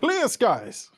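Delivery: high imitation of a game guard; Solasta guard call. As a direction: mimic a guard calling out the forecast. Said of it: "says it exactly like that".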